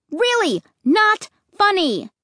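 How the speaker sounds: high, loud and indignant; angry scream. Acted. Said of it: "I screamed angrily".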